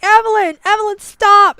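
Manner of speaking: high, loud desperate shout of a name; Astra yelling. Acted. Astra shouts at berserk Evelyn to stop, high desperate yell. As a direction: scream her name to snap her out of it. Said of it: "Astra's like".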